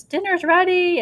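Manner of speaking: high, loud mother's holler down the stairs; mock mother calling up the stairs. Teacher voicing a mother calling kids to dinner, high loud holler.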